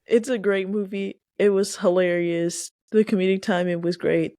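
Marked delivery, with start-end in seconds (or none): none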